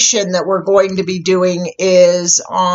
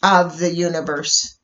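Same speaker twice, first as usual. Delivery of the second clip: guided meditation. Real, not acted. Host leading listeners into a bubble meditation, calm guided meditation. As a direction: ease them into stillness, unhurried, letting each phrase settle.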